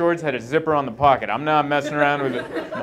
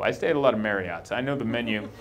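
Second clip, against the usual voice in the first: usual speaking voice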